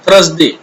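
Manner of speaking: थर्सडे said thrus-day, r right after th; misreading. Shown as a demonstration. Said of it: "लिखा रहता है". (थर्सडे को गलत 'थ्रसडे' बोलना)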